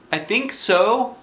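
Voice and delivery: put-on high, slow child voice; lightly voiced boy. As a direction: answer like a gentle little boy. Christopher voiced.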